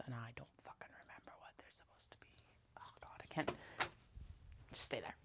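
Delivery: fast frustrated whisper to herself. Real, not acted. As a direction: hiss it quickly under the breath.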